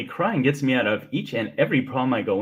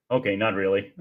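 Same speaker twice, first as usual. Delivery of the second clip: ordinary talk, nothing marked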